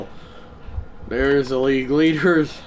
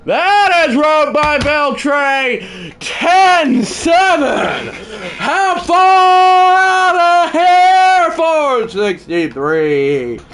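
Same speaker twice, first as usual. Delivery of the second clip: booming, slow, drawn-out announcer call; home run call; 14 semitones higher, 8 dB louder, much slower than usual. Acted. Mock play-by-play home run call.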